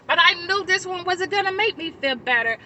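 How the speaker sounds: ordinary talk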